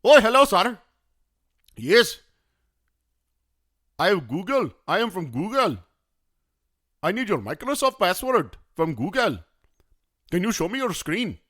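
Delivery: high-pitched, exaggerated mock Indian accent; Indian scammer accent. Phone scammer claiming to be from Google, mock Indian accent. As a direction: put on a caricatured Indian call-centre accent. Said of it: "Indian scammers".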